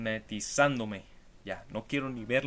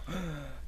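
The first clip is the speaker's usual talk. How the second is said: mock gasp; 4 semitones higher, 3 dB quieter than usual. Mock reality-show reaction.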